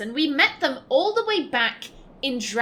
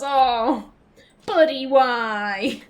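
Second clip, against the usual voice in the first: disappointed; 4 dB louder, much slower than usual. Real, not acted. Disappointed at old character.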